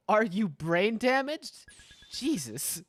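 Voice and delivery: ordinary talk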